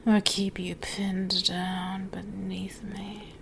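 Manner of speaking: slow, soft threatening whisper; hushed possessive threat. Acted. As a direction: let the threat hang quietly.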